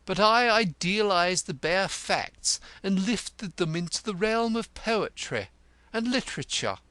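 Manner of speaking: high, lofty oration; indignant, pompous Cantercot. Acted. Cantercot defending his literary success to Grodman, high and pompous.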